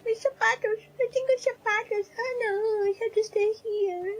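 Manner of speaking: high whiny fake sobbing; hammed-up wailing over lost shoes; mock crying. Mocking traumatized character.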